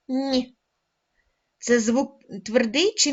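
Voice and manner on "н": lone soft Н, palatalized as нь. Shown as a demonstration. Teacher confirming for pupils, soft Н as нь. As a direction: say Н as нь once, with no vowel. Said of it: "М'який приголосний".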